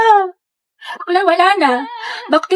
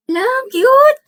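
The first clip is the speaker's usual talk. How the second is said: delighted squeal; 11 semitones higher than usual. Acted. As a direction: gush over the gift. Delighted by gift.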